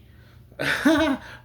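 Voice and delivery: high, loud burst of laughter; big laugh at himself; real loud laugh. Real, not acted. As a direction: crack up at the memory. Laughing at own hiding spot.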